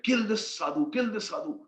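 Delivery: storyteller urging the kill like a bandit mob; mock mob cry. Voices attacking bandits in story.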